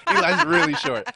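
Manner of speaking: high, loud laugh through the words. Real, not acted. Teasing his friend about his height, laughing.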